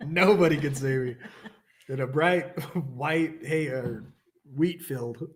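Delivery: laughing through the line; real amused laugh. Real, not acted. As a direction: giggle while telling it.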